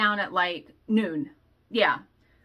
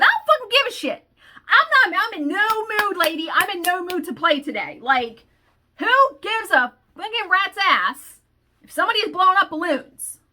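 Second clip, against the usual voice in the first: high, loud, exasperated rant; letting the cashier have it, fed up; angry outburst; 8 semitones higher, 4 dB louder than usual. Retold or imitated. Retold snapping at the cashier.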